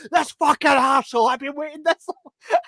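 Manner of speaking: hammed-up annoyed rant; mock angry customer. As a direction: grumble it in a silly high voice. Quoting someone angry.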